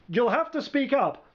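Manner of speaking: high, thin call from afar; small faraway voice; distant reply. A narrator's reading. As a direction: call back faintly across a field. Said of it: "came the distant reply".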